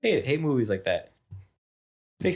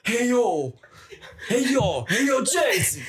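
higher, hollered mock boxer voice; hammed-up street-tough impression; Rocky Balboa; 8 semitones higher than usual. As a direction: call out like a movie palooka. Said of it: "Is that Rocky Balboa now?".

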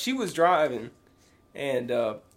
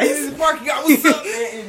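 mock greeting; 8 semitones higher, 6 dB louder than usual. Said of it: "echoes the pretend greeting".

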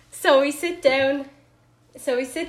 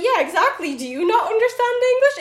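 high, louder mock-indignant comeback; exasperated retort; 10 semitones higher, 4 dB louder, faster than usual. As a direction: fire back fast, rising in disbelief. Acts out her retort in quarrel.

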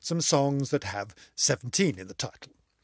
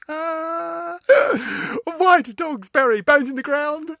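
high, laughing through the words; barely holding it together; laugh-crying; 13 semitones higher, 6 dB louder, slower than usual. Real, not acted. Telling a joke while laughing at it.

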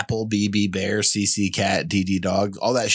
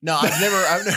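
laughing; 7 semitones higher, faster than usual. Real, not acted. Laughing denial.

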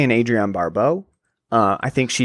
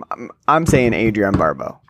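ordinary talk, nothing marked

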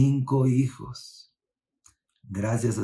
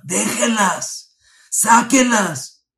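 high, loud, very slow barked command; angry order; 11 semitones higher, 8 dB louder, much slower than usual. Retold or imitated. General orders the girls released, furious and loud. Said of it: "El general al oír se puso enojado".

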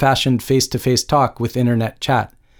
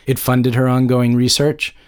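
usual speaking voice

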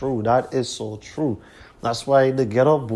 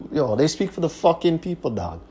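usual speaking voice

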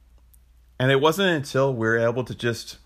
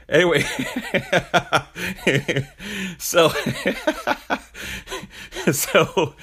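laughing; 7 semitones higher than usual. Real, not acted. Speaker cracking up at his own telling slip, laughing through words. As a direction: try to restart through helpless real laughter.

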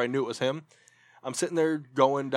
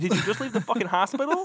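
incredulous laugh; 8 semitones higher than usual. Retold or imitated.

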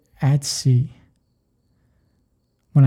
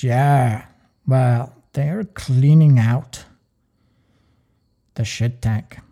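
slow, lower mock German captain accent; 5 semitones lower, much slower than usual. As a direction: drag out the German captain's offhand order. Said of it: "Apologize for my German accent".

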